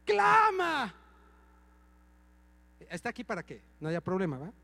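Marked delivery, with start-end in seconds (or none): 0.0-0.9 "Clama" agudo y fuerte, ordenando; orden imitada. Retold or imitated. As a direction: exigir a Ana que clame. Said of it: "Y le digo a Ana". (pastor ordena a su esposa Ana que clame)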